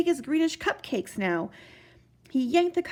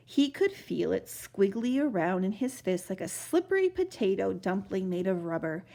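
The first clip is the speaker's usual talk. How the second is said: ordinary delivery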